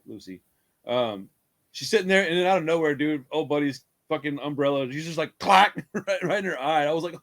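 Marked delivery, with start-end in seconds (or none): none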